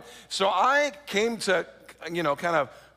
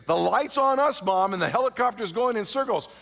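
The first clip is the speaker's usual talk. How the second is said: urgent; 6 semitones higher, 4 dB louder than usual. Retold or imitated. Urgently explaining to mom.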